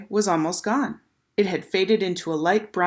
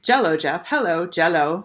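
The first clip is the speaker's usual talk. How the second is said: usual speaking voice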